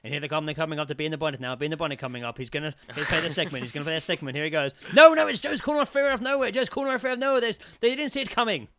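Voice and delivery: hammed-up commentator losing it; mock sports commentator. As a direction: call it like a horse race finish. Mock sports commentary on podcast segment.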